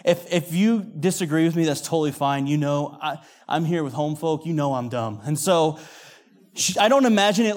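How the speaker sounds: normal talking voice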